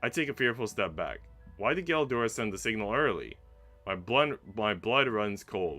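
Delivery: ordinary talk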